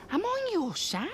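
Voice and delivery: high, slow, squeaky plea; high drawn-out mock-wounded voice; silly high voice. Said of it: "says something really funny".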